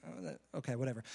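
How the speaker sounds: low, soft, dismissive reply; unimpressed mumble. Acted. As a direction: shrug it off quietly under your breath. Unimpressed crowd reacts to the boast.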